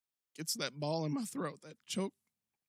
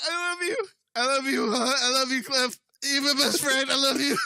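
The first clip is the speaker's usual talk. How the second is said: high, loud, hammed-up tearful declaration; fake-sob it like a wrestler overacting; Hulk Hogan crying; 8 semitones higher, 7 dB louder than usual. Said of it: "As Hulk Hogan, through his tears".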